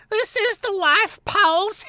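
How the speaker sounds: very high, squeaky mocking rat voice; mocking imitation. A narrator's reading. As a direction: mimic her in a nasty high squeal. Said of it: "mocking imitation of the Hogmaid's voice".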